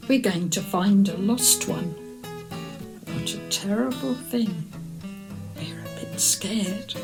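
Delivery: usual speaking voice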